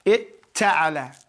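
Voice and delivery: slow repeat. Shown as a demonstration. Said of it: "it becomes itta'ala".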